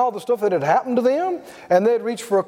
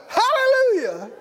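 much higher, slow rising hallelujah; exultant drawn-out praise; joyful shout; 12 semitones higher than usual. Retold or imitated. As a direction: soar over the top in triumph. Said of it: "he went".

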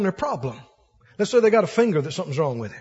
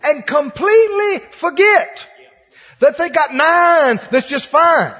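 higher, louder, slower climb; loud preaching; 6 semitones higher, 8 dB louder, slower than usual. Real, not acted. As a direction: belt out every blessing. Preacher building loud point.

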